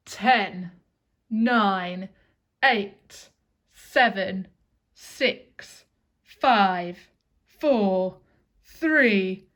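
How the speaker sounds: loud, forceful counting; loud countdown. Pretend. Teacher leading the class counting backwards, loud countdown. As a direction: call out each number firmly.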